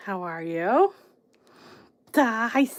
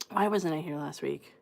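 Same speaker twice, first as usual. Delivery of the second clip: softer, lower self-question; musing to herself; 4 semitones lower, 8 dB quieter than usual. Real, not acted. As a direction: think aloud, quietly. Asking herself, thinking aloud.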